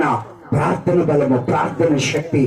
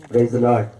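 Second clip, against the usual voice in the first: normal talking voice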